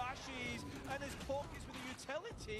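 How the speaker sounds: excited. Real, not acted. Caster hyping a big play.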